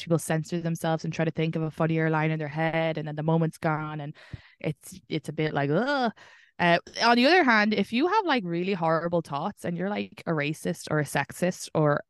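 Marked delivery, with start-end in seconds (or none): 5.7-6.1 higher-pitched mock "ugh"; cringing groan. Listener hearing a joke come too late, mock cringing groan. Reacting to a missed funny moment.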